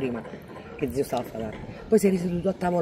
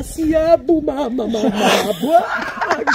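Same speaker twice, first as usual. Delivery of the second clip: put-on high, loud wailing baby babble, higher and louder; a hammed-up crying baby; imitated crying toddler; 8 semitones higher, 8 dB louder, much slower than usual. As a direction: mimic a toddler bawling for mamma. Baby crying at being carried.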